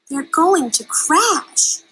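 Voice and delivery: high, loud, slowed; alarmed gasp. A narrator's reading. Stella Luna seeing the birds about to crash, alarmed gasp. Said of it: "gasped Stella Luna".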